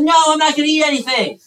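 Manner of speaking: put-on high, whiny kid refusing food; sulking little kid. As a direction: play a pouting child who won't eat. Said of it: "And he's like".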